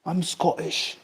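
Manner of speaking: understated Scottish accent impression; Scottish meme accent.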